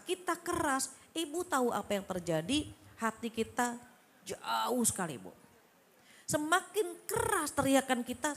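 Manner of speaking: usual speaking voice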